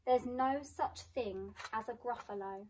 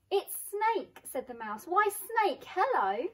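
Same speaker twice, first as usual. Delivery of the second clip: cheery greeting; 8 semitones higher, 7 dB louder than usual. Acted. Mouse greets snake cheerily.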